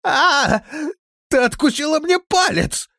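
very high, loud howl of pain; shrieked accusation; frantic scream. A narrator's reading. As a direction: scream in agony and horror. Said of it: "двор огласил истошный вопль".

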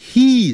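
"He" with a long "ee"; long vowel. Shown as a demonstration. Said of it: "He是发长的元音".

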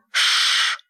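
sharp unvoiced vampire hiss. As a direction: hiss through the teeth in pain. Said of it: "зашипел вампир".

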